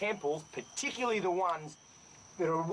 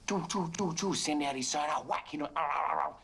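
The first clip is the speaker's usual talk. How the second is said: mock sonar noise. Shown as a demonstration. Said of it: "collect all of his echolocation".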